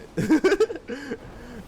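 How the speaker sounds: squeaky burst of laughter; high-pitched laugh. Real, not acted. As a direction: laugh up high at your own joke. Laughing at own joke.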